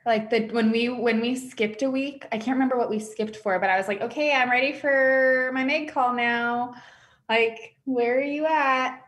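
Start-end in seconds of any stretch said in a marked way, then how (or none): 4.0-9.0 mock impatience. Client missing her skipped weekly call, mock impatience. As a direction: act out being impatient for the next call.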